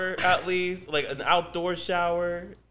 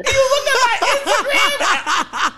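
laughing; 6 dB louder, much slower than usual. Real, not acted. Co-host cracks up at caller.

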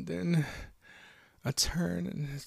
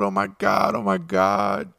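full-voiced, repeated outcry; loud shocked exclamation; loud panicked cry; 7 semitones lower, 13 dB louder than usual. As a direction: cry out as if waking in terror. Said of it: "I say her name loud".

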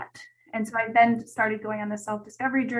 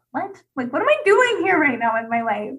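a high, louder disbelieving what, then loud bewildered questions; mock incredulity at a life choice; incredulous; 4 semitones higher, 6 dB louder than usual. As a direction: act baffled by the idea. Shocked at friend's suggestion.

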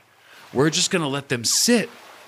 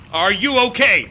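loud call; 9 semitones higher, 7 dB louder than usual. Acted. Calling to the meditating lady.

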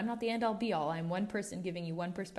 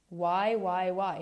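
louder insistent repeated why; emphatic why; 7 dB louder, much slower than usual. Real, not acted. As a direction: press the question home, louder each time. Emphatic repeated self-question.

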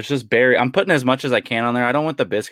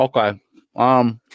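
mouth full; 2 semitones higher, 4 dB louder, slower than usual. Real, not acted. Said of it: "Don't talk with your mouth full".

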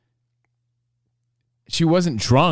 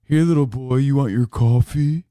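condescending. Retold or imitated. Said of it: "Spot so condescendingly says".

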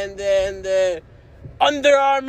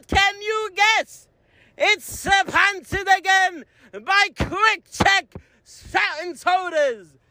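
slow, higher announcer voice; ad read; 6 semitones higher, slower than usual. Acted. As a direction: announce the sponsor slowly. Sponsor ad read.

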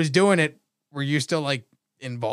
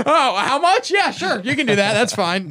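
eager; 7 semitones higher, 3 dB louder than usual. Retold or imitated. Acted eager young self, laughing.

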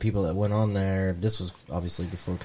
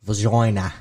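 vagina said the Australian way, vuh-JOY-nuh; mock Australian drawl on vagina, the middle vowel as oy; a joke Australian vagina; Australian accent; 4 dB louder, slower than usual. Shown as a demonstration. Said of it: "how to say vagina in Australian".

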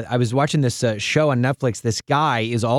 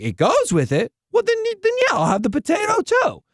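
put-on college kid. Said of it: "he's like".

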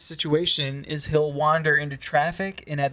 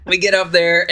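ordinary talk

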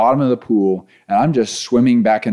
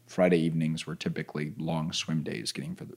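ordinary talk

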